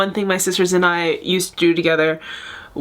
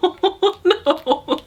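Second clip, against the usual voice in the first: real laughter through tears, pitched up; teary laughing; 9 semitones higher, much slower than usual. Real, not acted. Fan tearing up at the reunion, laughing through tears. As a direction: giggle helplessly while welling up. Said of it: "I'm getting teary-eyed".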